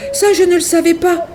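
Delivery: put-on high little-girl voice, sorry; a small girl excusing herself; Goldilocks apologising. A narrator's reading. Goldilocks apologises to mama bear, high and sorry. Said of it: "répond Boucle d'Or".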